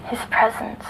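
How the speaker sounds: soft, half-whispered telling; hushed recollection. Acted. Girl quietly telling the doctor of the Candleman. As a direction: recall the figure as if afraid to wake it. Girl recounting a nightmare figure.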